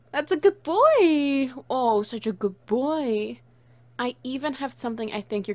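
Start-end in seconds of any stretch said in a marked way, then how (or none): 0.1-1.5 high, loud, slow praise for a dog; gushing pet praise. Acted. Praising the puppy. 1.7-3.4 slow, gentle dog praise. Acted. Praise again, calmer.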